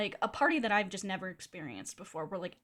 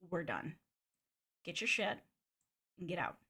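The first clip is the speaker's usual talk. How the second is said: low, quiet imitation of cold anger; pissed; 4 semitones lower, 6 dB quieter than usual. Host throwing guest out of party, quiet pissed imitation. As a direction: order them out softly, in separate short bursts. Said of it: "that's how he says that to me".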